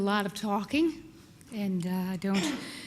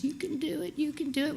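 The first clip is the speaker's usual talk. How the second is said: encouraging coach; 7 semitones higher than usual. Retold or imitated. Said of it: "She was very encouraging".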